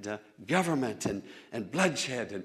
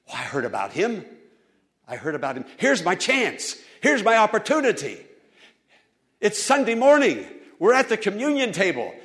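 eager hope; 7 semitones higher, 8 dB louder than usual. Retold or imitated. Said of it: "blind Bartimaeus apparently asked".

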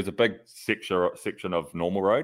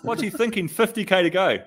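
mock exasperated partner; 12 semitones higher, 3 dB louder than usual. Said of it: "she was just like".

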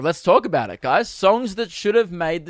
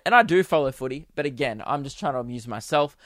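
usual speaking voice

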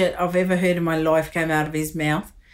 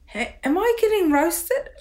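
mock confused surprise; 8 semitones higher than usual. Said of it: "you gotta go".

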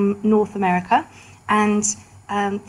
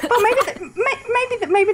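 laughing; 13 semitones higher than usual. Real, not acted.

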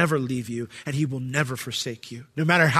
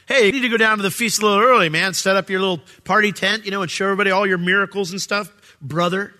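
fast, louder mocking taunt; mocking brothers; 5 semitones higher, 5 dB louder, faster than usual. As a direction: sneer it like a teasing brother. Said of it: "They're mocking him".

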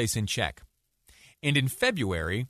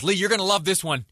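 high, slightly louder call across the room; imitated yell; 8 semitones higher, 3 dB louder than usual. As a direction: yell over to a colleague, pitched up. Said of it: "yells over to me".

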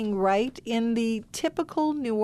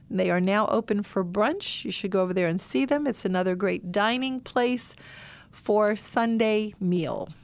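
usual speaking voice

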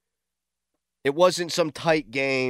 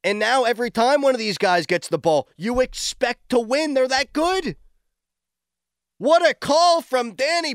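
hyped up; 8 semitones higher than usual. Real, not acted. Host raving to listeners about his starters, excited. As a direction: sell it like a believer.

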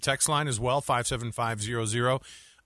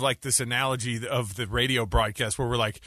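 normal talking voice

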